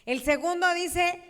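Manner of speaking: ordinary talk, nothing marked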